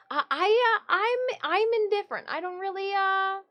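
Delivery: high, hesitant cat voice; Stitch voice. Pretend. Old cat Stitch shrugging at chat, high and halting. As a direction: stammer high as the indifferent cat. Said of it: "Stitch says".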